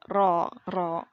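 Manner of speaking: ro with a light rolled r; r bergetar ringan; trilled r. Shown as a demonstration. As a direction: roll the r a couple of times. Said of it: "Jadi kasih getar aja gitu".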